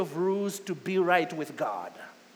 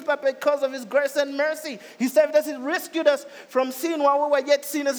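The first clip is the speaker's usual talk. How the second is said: higher, louder, surging preacher's climax; full-force preaching; 6 semitones higher, 4 dB louder than usual. Acted. Preacher proclaiming grace to the congregation, fervent and loud. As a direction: lift the congregation with rising fervor.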